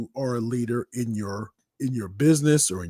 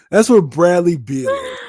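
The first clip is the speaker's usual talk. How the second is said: laughing; 5 semitones higher, 7 dB louder than usual. Real, not acted. Repeats punchline laughing.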